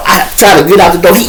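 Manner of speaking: ordinary talk, nothing marked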